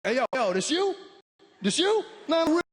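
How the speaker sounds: high, loud, hammed-up street greeting; mock cool guy greeting a friend. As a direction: hype him up like he's the real deal. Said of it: "They just".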